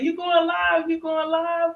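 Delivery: high, eager mimic of her daughter; mother imitating her daughter's eager question, high. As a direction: imitate the daughter's excited asking, pitched high. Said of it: "now she'd be like".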